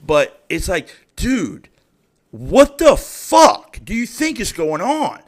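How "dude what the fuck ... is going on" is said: high, loud, slowed-down disbelief; exasperated outburst. Real, not acted. Ranting about a pushy ex-hire, loud exasperated. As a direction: stretch it out in outraged disbelief.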